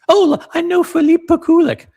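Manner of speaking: high, loud squeaky mock of a bragging viewer; mock know-it-all viewer. Mocking a viewer who claims to know the brands, high and loud. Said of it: "if you really know these brands".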